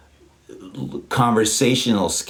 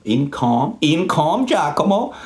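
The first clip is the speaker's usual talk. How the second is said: each repeat climbs higher into a squeak; sing-song climbing wordplay, higher each time; rising playful repeat; 9 semitones higher, slower than usual. Shown as a demonstration. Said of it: "word play building into name".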